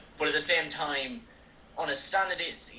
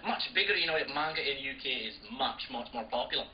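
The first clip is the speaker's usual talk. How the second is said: put-on light Scottish accent on "much more popular"; a hint of a TV Scottish accent; 4 dB quieter than usual. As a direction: slip into a mild Scottish accent. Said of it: "start talking Scottish".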